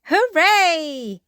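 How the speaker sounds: loud high hooray; cheering. A narrator's reading. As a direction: kids cheering together. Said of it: "everyone cheered".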